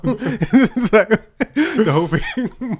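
high, slow laughing talk. Real, not acted. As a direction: laugh through the words. Laughing at the imagined listener.

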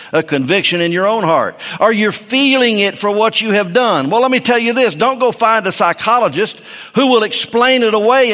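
high, loud pulpit preaching; preaching at full force. Acted. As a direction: drive it home to the congregation.

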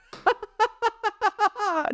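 high burst of real laughter; big laugh. Real, not acted. Host laughing at his father-in-law's idea, big real laugh.

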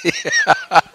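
squeaky burst of laughter; a high joyful laugh; high delighted laugh. Real, not acted. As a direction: let a giddy laugh escape.

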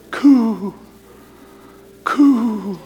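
high cooing like a dove. Retold or imitated. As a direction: coo back lovingly. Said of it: "Let's coo back".

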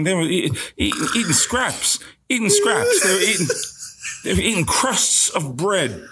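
high-pitched emphatic disbelief; worked-up comic outrage; indignant rant. Acted. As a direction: climb high on the repeated phrase. Old-actor character rants about starving colleagues.

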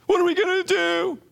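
high-pitched hammed-up wail; mock crying. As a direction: wail it very high, like a pretend crybaby. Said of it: "And Joshua's crying".

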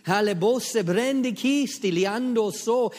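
slow, loud, high ecstatic tongues; loud tongues prayer. Acted. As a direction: cry out in tongues, slowly. Praying in tongues.

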